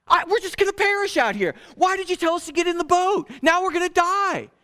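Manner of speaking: panic, sure we're going to drown; freaking out. Acted. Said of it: "we freak out".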